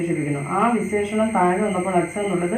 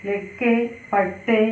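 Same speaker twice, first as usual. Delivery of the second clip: slow, deliberate Hindi plural read; teacher reading the plural sentence slowly; slow model sentence; much slower than usual. Shown as a demonstration. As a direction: stretch each word out for the learners. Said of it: "ഈ സെന്റൻസുകളുടെ പ്രത്യേകത നോക്കിയേ".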